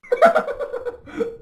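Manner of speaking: high, mostly voiceless giggling; laugh. Real, not acted. Guest cannot prove the answer to interviewers, laughing.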